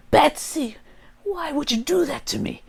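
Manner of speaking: Kevin Michael Richardson's Joker. Acted. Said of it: "It goes back and forth".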